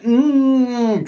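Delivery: long high "mmm" through a gag; high-pitched, muffled plea; muffled gagged moan. Acted. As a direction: moan as if a sock is in your mouth. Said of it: "They're all like".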